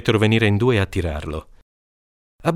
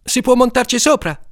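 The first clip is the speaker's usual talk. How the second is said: put-on kid's question; 4 dB louder than usual. Menin asks timidly.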